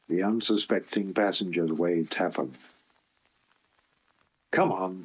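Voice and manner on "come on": ordinary delivery, nothing marked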